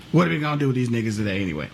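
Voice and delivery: put-on low, quick, scornful old planter; mock slaveholder. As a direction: mutter it like a cold plantation owner. Voicing slaveholders about freed slaves.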